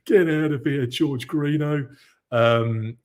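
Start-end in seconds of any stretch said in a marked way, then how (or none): 0.1-1.9 playful, drawn-out brush-off; slow, laughing-it-off "get out of here"; mock dismissal. Real, not acted. Host waving off a viewer's compliment, mock dismissal.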